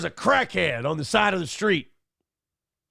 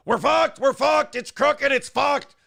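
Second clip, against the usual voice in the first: much higher, louder chant of doom; mock Alex rant; 10 semitones higher, 4 dB louder, slower than usual. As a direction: parody his doom catchphrase. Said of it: "the guy whose whole thing is".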